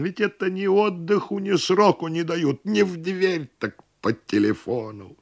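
high-pitched aggrieved lament; sorrowful wailing. A narrator's reading. Host bewails endless levies, woefully. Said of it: "горестно завопил хозяин".

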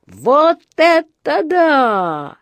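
put-on a boy's high, slowed-down cry of wonder; high drawn-out amazed exclamation; excited shout. A narrator's reading. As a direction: shout it out, amazed at what he sees. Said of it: "внезапно закричал Джонатан".

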